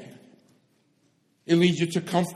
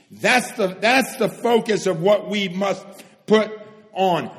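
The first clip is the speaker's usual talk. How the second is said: normal talking voice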